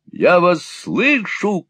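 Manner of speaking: high, slow shouted cry. A narrator's reading. Gride shouting to alarm the street, high yell. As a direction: yell it up to the window. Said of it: "крикнул он".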